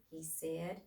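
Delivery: ordinary delivery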